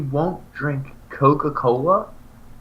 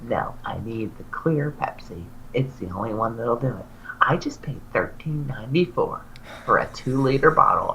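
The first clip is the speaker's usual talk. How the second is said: mock whisper; 6 dB quieter than usual. Friend's insistent phone reply, retold.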